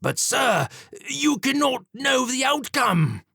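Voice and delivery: high, slow, desperate cry; desperate demon. A narrator's reading. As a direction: plead high and strained, losing ground. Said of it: "Did he sound desperate?".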